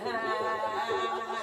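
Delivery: high, loud comic plea to the food; mock panic. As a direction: escalate into a high mock-desperate cry.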